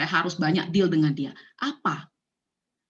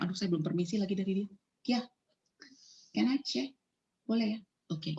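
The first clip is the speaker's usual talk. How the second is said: quick, much softer aside to her daughter; hushed aside; 9 dB quieter than usual. Real, not acted. Speaker quietly asks her daughter permission, soft hurried aside.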